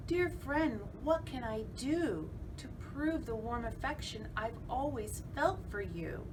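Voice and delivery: high, coaxing villain voice; cunning spider. Acted. Spider flattering the fly to lure her, coaxing. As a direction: lure the guest in sweetly. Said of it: "Said the cunning spider".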